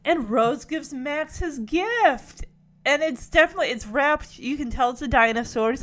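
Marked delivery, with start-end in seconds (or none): none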